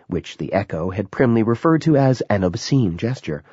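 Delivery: ordinary delivery, nothing marked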